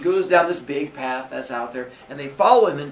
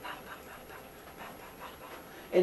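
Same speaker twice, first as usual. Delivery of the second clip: breathy rowing chant, under the breath; teacher miming Aesop rowing, in a whisper; whispered paddling; 16 dB quieter, faster than usual. Acted. Aesop rowing, acted sound.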